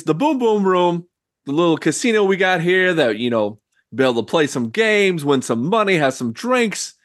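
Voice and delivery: high, brash character voice; gangster greeting. Acted. Host introducing himself to the newcomer, high and brash. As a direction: come on friendly and pushy like a mobster. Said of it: "And he's like".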